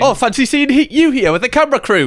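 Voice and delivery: hammed-up fake chance meeting; awkward greeting. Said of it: "awkward, fake sort of greeting".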